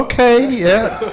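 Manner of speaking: high, laughing backdown; nervous laughing okay. Retold or imitated. Speaker backing down under the table kick, nervous laugh. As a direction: give in with an awkward laugh. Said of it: "I'm like".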